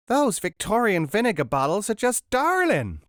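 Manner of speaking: high, loud gushing American shriek; shrill delighted American scream; American woman screaming. A narrator's reading. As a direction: squeal it like a thrilled American tourist. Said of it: "screamed one woman".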